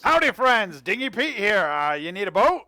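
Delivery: high, loud cheery hawker voice; eager boatman pitching his ride; folksy salesman. Acted. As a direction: sell the boat like a friendly huckster. GM voices Dingy Pete selling.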